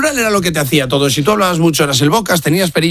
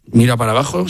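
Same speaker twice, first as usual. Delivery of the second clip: ordinary delivery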